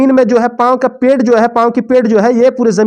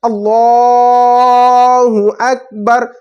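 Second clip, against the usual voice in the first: imam's drawn-out call; imam's takbir; much slower than usual. Shown as a demonstration. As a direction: call it out like the imam leading prayer. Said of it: "फिर से बोलेंगे".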